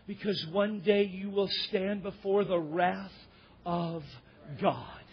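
slow, low, weighty pauses; solemn preaching. Real, not acted. Preacher warning congregation of coming wrath, solemn and slow. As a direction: let each phrase land gravely, dropping low on the last word.

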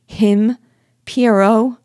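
high, loud incredulous questions; cry of surprise. A narrator's reading. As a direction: exclaim in astonishment, pitch leaping up. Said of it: "cried Marie in surprise".

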